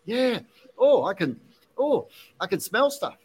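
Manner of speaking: put-on the kid amazed he can smell things; retold excitement. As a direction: kid discovering aromas, mildly excited. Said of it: "he got all excited".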